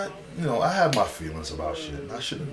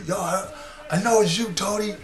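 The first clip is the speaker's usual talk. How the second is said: high, louder mock yell; 13 semitones higher, 5 dB louder than usual. Tony yelling through the window at the pranksters, mock yell.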